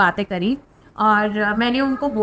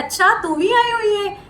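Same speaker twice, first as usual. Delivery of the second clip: lightly voiced Guruji, pitched higher and pleased; a hint of Guruji greeting her, higher; Guruji's voice; 8 semitones higher than usual. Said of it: "गुरुजी कहते हैं". (हल्के से गुरुजी की आवाज़ में, ऊँचे सुर में)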